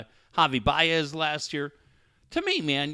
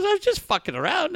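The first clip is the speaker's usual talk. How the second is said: higher voice, playfully defensive; imitating his friend. Host voicing friend explaining her text, higher playful voice. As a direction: insist it was a joke in her higher voice. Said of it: "she goes".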